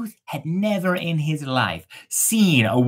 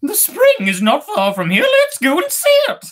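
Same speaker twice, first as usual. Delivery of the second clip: very high, louder excited bird; first bird excited; 5 dB louder than usual. Acted. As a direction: chirp eagerly, rising to a squeak. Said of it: "said the first bird".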